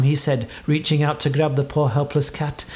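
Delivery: ordinary delivery, nothing marked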